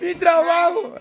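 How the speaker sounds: voz de personaje mucho más aguda, como de juguete; voz de la Chiclín. Acted. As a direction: pon en su boca una vocecita aguda y quejosa. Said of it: "dice la Chiclín". (vocecita muy aguda de mascota que se queja)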